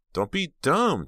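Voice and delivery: high, loud exclamation; exclaiming. Acted. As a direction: exclaim it sharply. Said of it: "Jade exclaimed".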